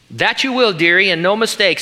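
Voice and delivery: a hint of a fussy, knowing character; Missus Beaver. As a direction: read it as an emphatic character line. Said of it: "said Missus Beaver".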